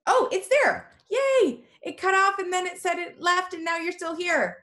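real high-pitched squeal of relief; excited relief. Real, not acted. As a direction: cheer as the lost call returns. Video call comes back.

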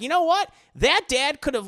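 firing up the point; excited reaction. Retold or imitated. Podcaster arguing about the dad, worked-up voice.

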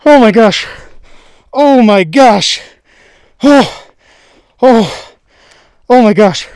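real loud, stunned outbursts; freaking out. Real, not acted. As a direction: cry out in overwhelmed disbelief, voice jumping up. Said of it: "I'm freaking out".

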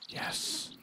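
tiny voiceless yes; hushed excited yes; whispered yes. Real, not acted. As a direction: whisper the win under your breath. Quiet triumph while aiming.

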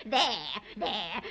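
gleeful cackle; witch cackle. Acted. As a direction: cackle at the rain. Witch gloats over spell.

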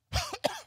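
acted fit of coughing; sick coughing. The son suddenly falls ill, coughing. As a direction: cough in short repeated bursts. Acts the boy falling sick.

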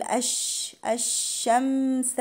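"الشمس" with a long, airy sh; spreading shin. Shown as a demonstration. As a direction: let the ش of "الشمس" spread in a sustained hush. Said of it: "والشين فيها تفشي".

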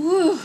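high, loud "woo" exhale; deep breath out. Acted. Mother and daughter regroup again, deep exhale. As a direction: let out a big calming whoo. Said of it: "they let out a deep breath".

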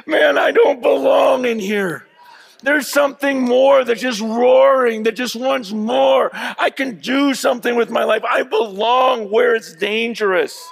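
high, loud fervent preaching shout; preached cry. Acted. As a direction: cry it out at full force from the pulpit. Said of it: "sitting in these cages going".

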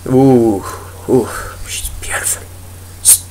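breathless whispered admiration; awed whisper. Real, not acted. Gamer gazes at mountains, hushed awed whisper. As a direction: whisper it as if stunned by beauty.

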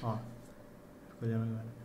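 usual speaking voice

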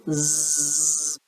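soft sleepy snore; drowsy bear snoring. Acted. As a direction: let out a quiet snore. Bear falls asleep snoring.

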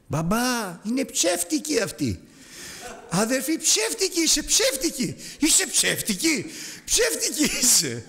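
put-on high, slow little girl's cry of ψεύτικη; a toddler calling out to her dad; child shouting. Toddler shouting to her dad about the woman, high child's voice. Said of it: "και αρχίζει και φωνάζει".

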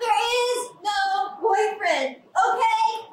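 slow and insistent, pressing the words out. Acted. Sister forces her brother to hear there is no boyfriend, insistent. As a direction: spell it out so he finally hears. Sister finally admits the truth.

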